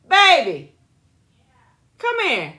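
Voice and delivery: high, loud call to someone away. Real, not acted. Summoning baby to come here, loud high call. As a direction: holler for them to come over. Calling partner over.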